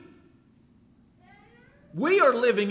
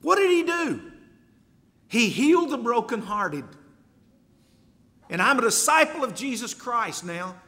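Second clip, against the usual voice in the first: louder and higher, pressing each word home; fervent preaching; 4 semitones higher, 3 dB louder than usual. Real, not acted. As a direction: preach it hard to the congregation. Preaching at full force.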